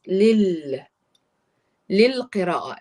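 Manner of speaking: "lil" said with the two lams merged into one sound; blended lil. Shown as a demonstration. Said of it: "so you go like this". ("lil" with the lams blended together)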